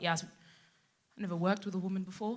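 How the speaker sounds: soft, low confession; imitating a hesitant male coworker; awkward colleague. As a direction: say it quietly and carefully. Voices colleague in the car.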